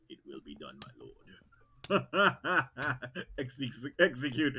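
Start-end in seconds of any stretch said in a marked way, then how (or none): 0.1-1.2 low, soft obedient murmur; servile villain reply. Acted. Clone answering the dark lord, low and soft. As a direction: answer your master, quietly. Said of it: "You sound like Palpy".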